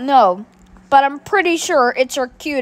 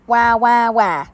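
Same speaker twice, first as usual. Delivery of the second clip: fake crying. Caillou fake crying in box.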